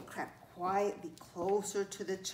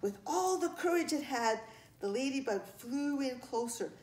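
usual speaking voice

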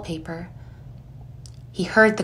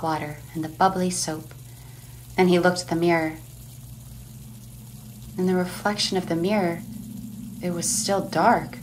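hushed narration; 7 dB quieter than usual. A narrator's reading.

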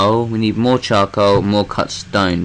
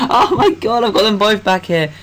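laughing panic; 10 semitones higher than usual. Real, not acted. Chased by two monsters, laughing in high panic.

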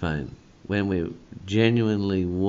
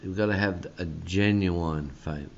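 ordinary talk

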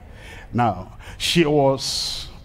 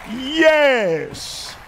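higher and louder, a triumphant cry; 9 semitones higher, 3 dB louder than usual. Real, not acted. Preacher to congregation, high triumphant cry. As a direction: announce her yes with high, loud triumph.

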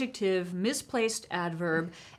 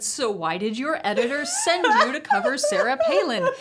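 higher and louder, a laugh breaking through; laughing question; 7 semitones higher, 5 dB louder than usual. Retold or imitated. Pressing the reporter about his editor, laughing. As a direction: push the question while giggling.

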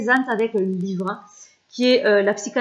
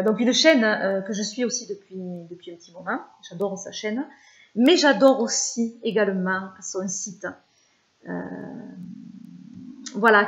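ordinary talk

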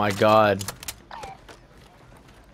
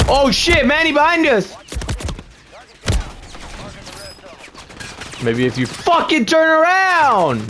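panicked yelling; 11 semitones higher than usual. Real, not acted. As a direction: shout in a sudden panic. Teammate under fire, yelling.

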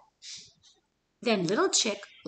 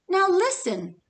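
higher, louder call; 8 semitones higher, 4 dB louder than usual. Acted. Big Chick calling out to the dog, higher louder call. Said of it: "he called".

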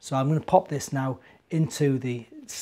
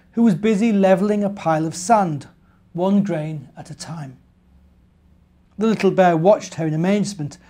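bright, lively storybook voice, a little higher and louder; reading to children; 5 semitones higher, 7 dB louder than usual. A narrator's reading. Presenter reading an Advent story to children, lively storybook voice. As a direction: tell it like a bedtime story for little ones.

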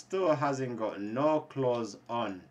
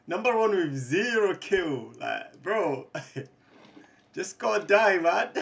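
loud jeer breaking into laughter; mock him while laughing; laughing taunt; 7 semitones higher, 6 dB louder than usual. Real, not acted. Streamer mocking a player with zero kills, laughing.

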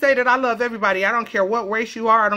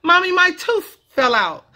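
a hint of a little child telling mom; lightly voiced five-year-old; 6 semitones higher than usual. Five-year-old telling mom about a tooth, lightly voiced child. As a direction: lightly imitate a small kid. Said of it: "my little five-year-old told me".

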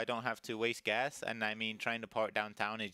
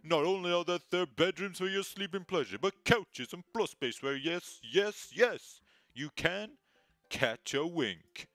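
high, slow, hammy real estate announcer; cheesy announcer voice; 8 semitones higher, 3 dB louder, slower than usual. Acted. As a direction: sell the house like a cheesy announcer. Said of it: "do my best cheesy announcer voice".

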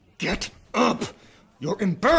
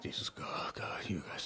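whispering; 14 semitones lower, 20 dB quieter than usual. Acted. Exasperated aside to crew.

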